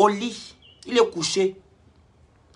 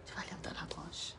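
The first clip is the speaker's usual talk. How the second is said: whispered repeat; 21 dB quieter than usual. Real, not acted. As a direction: repeat the question in a voiceless whisper. Répète la question en aparté. (question répétée en chuchotant)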